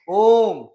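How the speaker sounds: ओम loud and long, held out; calling-length ओम; prolonged om. Shown as a demonstration. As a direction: chant ओम with a stretched vowel. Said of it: "दूर से बुलाने में इनका प्रयोग".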